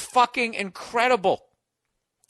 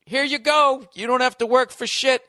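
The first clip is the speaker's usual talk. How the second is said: mock casting agent; 6 semitones higher, 3 dB louder than usual. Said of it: "And then".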